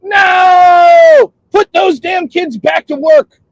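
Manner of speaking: high, drawn-out outraged shout; mock outrage. Mock protester demanding kids go back to work, outraged shout. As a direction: yell it like a fired-up protester.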